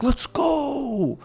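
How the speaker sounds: mock hype. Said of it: "and he's like".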